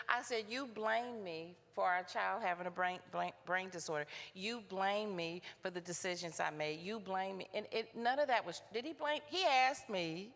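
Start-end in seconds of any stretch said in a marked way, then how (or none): none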